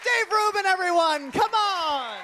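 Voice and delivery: high, loud hype greeting; excited shout. Real, not acted. Comic bursting on stage, greeting the host loudly.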